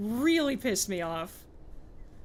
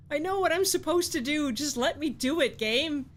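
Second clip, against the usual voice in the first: exasperated; 6 semitones higher, 3 dB louder than usual. Real, not acted. Exasperated plea to the game.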